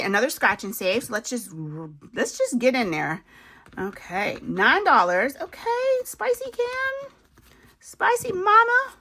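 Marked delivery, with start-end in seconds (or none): 5.4-8.9 much higher, teasing put-on voice; a silly high voice calling out a nickname; playful put-on voice.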